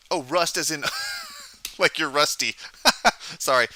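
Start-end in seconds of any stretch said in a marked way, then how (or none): 0.9-1.7 quick high-pitched laugh; short amused laugh. Real, not acted. Misheard rust joke. 2.6-3.3 bright burst of laughter; short laugh; high laugh. Real, not acted. Misheard rust joke.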